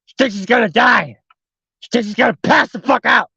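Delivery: usual speaking voice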